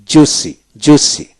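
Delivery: slow, demonstrated "জোসি", twice; silent I. Shown as a demonstration. Teacher showing learners a silent letter, slow "জোসি" without I. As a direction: say "জোসি" slowly, I left silent. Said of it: "এইভাবে উচ্চারিত হবে".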